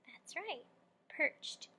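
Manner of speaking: perched said alone, soft and crisp; stressing perched. Shown as a demonstration. Teacher praising a child for framing the word, perched stressed. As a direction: set apart the word perched. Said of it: "frame the word perched".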